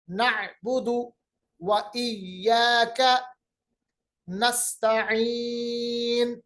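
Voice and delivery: slow, elongated Quran recitation; tajweed recitation. Shown as a demonstration. Teacher reciting for students to repeat, slow tajweed. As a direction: draw out the vowels, phrase by phrase.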